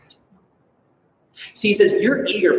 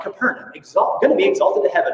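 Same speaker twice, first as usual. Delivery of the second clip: much higher, a boastful civic slogan; proud city motto; 8 semitones higher, faster than usual. Retold or imitated. Preacher imitating Capernaum's proud motto, boastful and high. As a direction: toss it off like the town's own proud boast. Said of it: "it was something along the lines of".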